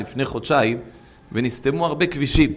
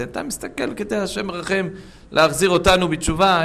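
ordinary delivery

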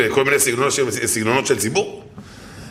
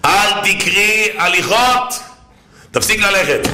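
preaching emphasis; 6 semitones higher, slower than usual. Acted. Preacher drives home the point.

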